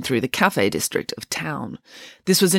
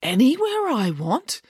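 slow, high-pitched wonder; excited; 9 semitones higher, slower than usual. A narrator's reading. Boris may choose any restaurant, excited. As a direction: savour the question with high, eager excitement. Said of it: "asked Boris excitedly".

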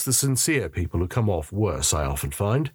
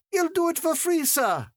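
much higher woman's voice, firm; Alicia's voice. A narrator's reading. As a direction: cut in brightly and take charge. Alicia's voice breaks in.

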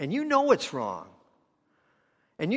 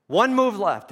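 emphatic; 6 semitones higher, 8 dB louder than usual. Acted. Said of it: "preacher stressing the key line".

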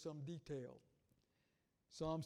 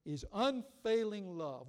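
loud, declaiming the psalm; preaching voice, full conviction; scripture read with force; 5 dB louder, slower than usual. Acted. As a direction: proclaim it to the congregation. Slow, building psalm reading.

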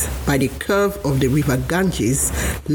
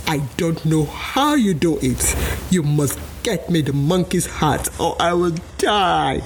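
mock cry. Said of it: "cried Missus Alligator".

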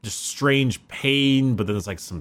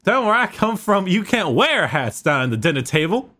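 put-on higher-pitched Louisiana Southern accent; Louisiana grandma; 7 semitones higher than usual. Grandmother correcting a guest's hat at dinner, Louisiana accent. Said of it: "my fucking grandmother's from Louisiana".

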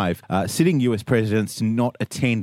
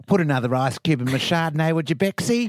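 mock future Bexy; 7 semitones higher than usual.

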